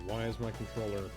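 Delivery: ordinary delivery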